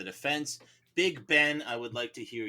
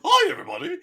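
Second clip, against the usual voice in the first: much higher and louder, cartoonish foreman; jury foreman greets everyone in a silly voice; 13 dB louder, faster than usual. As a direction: squeak out a goofy greeting as the foreman. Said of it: "I could do the foreman as the voice".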